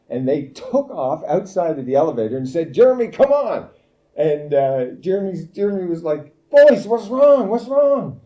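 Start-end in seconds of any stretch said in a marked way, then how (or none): 2.7-3.7 high, loud urgent call to a friend. Retold or imitated. Boys calling back to Jeremy. 6.5-8.2 high, loud bewildered call after them; puzzled call. Retold or imitated. Jeremy confused by fleeing boys.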